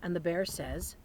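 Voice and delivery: lively picture-book read-aloud; reading to children. Acted. Teacher reading Thanksgiving book to children, lively read-aloud. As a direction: read it like story time for little ones.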